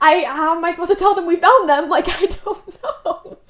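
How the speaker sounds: loud, high, laughing through the words. Real, not acted. Speaker laughs telling a podcast host about the awkward referral. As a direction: crack up at the absurdity.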